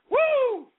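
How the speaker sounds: high, drawn-out whoop. Real, not acted. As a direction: let out a high "woo" of hype. Whoops after feeling hardcore.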